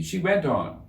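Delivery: ordinary delivery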